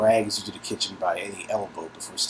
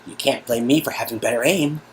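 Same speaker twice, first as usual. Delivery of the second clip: a touch higher, bright gamer brag; lightly voiced [name]; 4 semitones higher, 6 dB louder than usual. [name] teasing Nadia over the game, lightly voiced. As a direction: tease her about his better aim, a bit higher.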